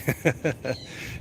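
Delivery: short amused chuckle; quick burst of chuckling; real laughing. Real, not acted. Laughs at viewer's comment.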